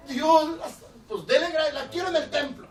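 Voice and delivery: mother overjoyed, thanking God loudly. Retold or imitated. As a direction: shout thanks like a relieved mother.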